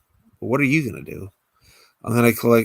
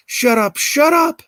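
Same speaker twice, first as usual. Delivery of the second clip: high, loud scream building; frantic yell; 7 dB louder than usual. Acted. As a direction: scream at the voice in the corner. Alexis yells at hallucination.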